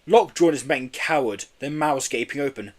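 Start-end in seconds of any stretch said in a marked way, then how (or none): none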